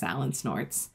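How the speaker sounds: ordinary delivery, nothing marked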